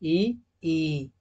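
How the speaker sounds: ई said short, then ई drawn out long; two i sounds demo. Shown as a demonstration. Teacher showing students short and long ई side by side. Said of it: "छोटी ई और बड़ी ई".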